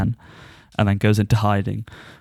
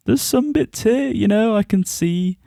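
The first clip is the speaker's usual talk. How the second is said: much higher, mock-knowing inner voice; high self-quote; 11 semitones higher than usual. Recalling her thoughts mid-episode, much higher mock voice. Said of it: "I was like".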